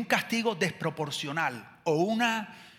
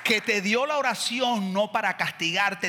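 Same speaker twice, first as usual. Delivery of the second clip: ordinary talk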